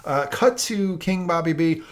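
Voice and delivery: normal talking voice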